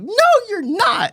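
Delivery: high, slow mock insistence; mock dad denying it again, slow; stubborn dad. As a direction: drag out the denial, high. Dad's yelling blowout on phone.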